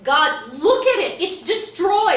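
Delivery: loud, high, upset outburst. Acted. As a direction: cry out in dismay over the mess. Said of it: "You don't have to get upset".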